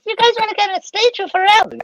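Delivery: very high falsetto impression; imitated squeaky voice. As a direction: mimic him in a sky-high, comic falsetto. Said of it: "this is his voice".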